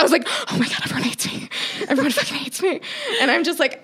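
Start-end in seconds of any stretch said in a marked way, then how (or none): 0.5-2.8 higher-pitched, mildly panicked retelling; mock panic. Host retells dreading the comments, mock panic. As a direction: play up a touch of panic.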